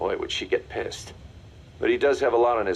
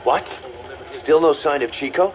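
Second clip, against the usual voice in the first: alarmed; 6 semitones higher, 4 dB louder than usual. Acted. Alarmed by news on the phone.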